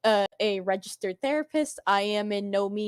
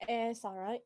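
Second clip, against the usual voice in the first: quiet; 11 dB quieter than usual. Real, not acted. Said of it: "your mic is really low".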